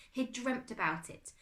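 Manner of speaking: plain reading voice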